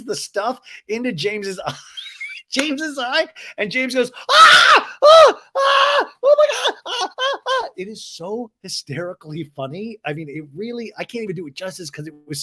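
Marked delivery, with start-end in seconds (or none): 4.3-7.7 very high, loud panicked shrieks; mock shrieking in pain, piercingly high; imitated little-girl scream. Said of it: "He screams like a little girl".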